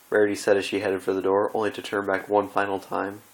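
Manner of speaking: ordinary delivery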